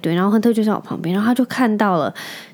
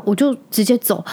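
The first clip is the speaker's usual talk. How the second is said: ordinary delivery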